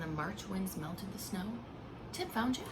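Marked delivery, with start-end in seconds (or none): none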